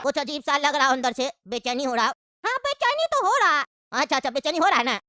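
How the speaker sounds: put-on low, easygoing old uncle. Uncle probes slyly.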